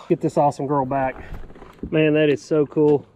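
normal talking voice

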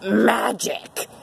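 normal talking voice

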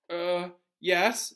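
mildly nervous, hesitant yes. A narrator's reading. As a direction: answer with a touch of nerves. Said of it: "said Neville nervously".

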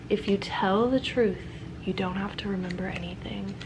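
soft whispered reading. Real, not acted. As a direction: whisper the fortune. Reading fortune aloud softly.

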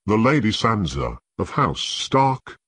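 plain reading voice

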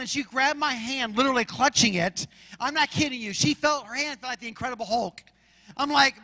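normal talking voice

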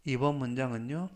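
usual speaking voice

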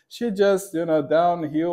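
usual speaking voice

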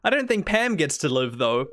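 ordinary talk, nothing marked

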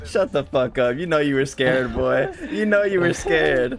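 jeering at the enemy over voice chat; yelling taunt. Real, not acted. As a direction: shout him down and rub it in.

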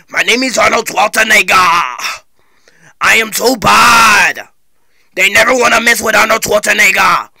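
action-hero boasting in an imitated accent; tough-guy celebrity impression; Arnold Schwarzenegger. Shown as a demonstration. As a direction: boast like a muscleman, drawing out the last words. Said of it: "that's the sound of Arnold Schwarzenegger".

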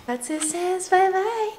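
high, slow sing-song for the dog; baby talk to pet. Pretend. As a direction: coo it like speaking for a puppy. Voicing goodbye for the dog.

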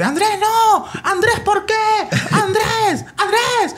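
high-pitched frantic call of a missed chance; frantic football commentator pleading a name; excited goal-call narration. Retold or imitated. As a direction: replay your own live call, voice soaring. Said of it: "en el cual yo le digo".

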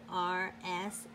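plain reading voice

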